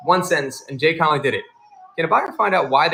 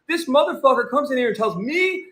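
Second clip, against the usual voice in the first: sneering seller; 10 semitones higher than usual. Retold or imitated. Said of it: "He's like".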